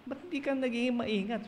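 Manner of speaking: high-pitched parental telling-off; mock scolding. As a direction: act out scolding a child over spilled milk. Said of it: "Pinapagalitan natin yung ano, yung bata".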